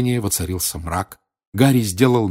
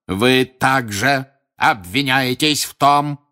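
slow, louder, higher stern shout; straining his voice; 5 semitones higher, 4 dB louder, slower than usual. A narrator's reading. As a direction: bellow the charge, slowly. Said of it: "надрывал голос мистер Крауч".

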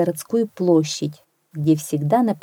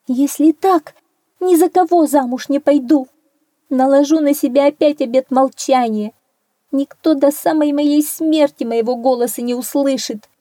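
high, loud, distressed vow; tearful princess; 7 semitones higher, 4 dB louder than usual. A narrator's reading. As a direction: declare it in raised, upset protest, acted. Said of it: "Зарыдала, заплакала королевна". (отчаянная клятва плачущей королевны, наигранно)

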